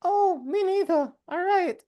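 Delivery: put-on high, sweet little girl voice; chirpy small kid answering; wholesome 1950s kid. GM voicing Red.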